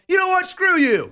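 mock comeback. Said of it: "you're like".

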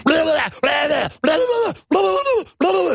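very high mock babble of blah; high-pitched mock blah blah chant; imitated kids babbling. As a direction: squeal blah over and over like children. Imitating kids screaming nonsense.

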